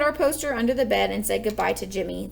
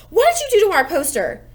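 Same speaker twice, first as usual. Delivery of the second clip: high and loud, demanding; yelling; 8 semitones higher, 8 dB louder than usual. A narrator's reading. Peter yelling at Fudge about the poster, loud and high. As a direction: shout the question at the little brother. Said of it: "I yelled".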